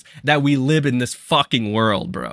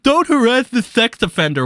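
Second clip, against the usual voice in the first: mocking voice; 7 semitones higher, 3 dB louder than usual.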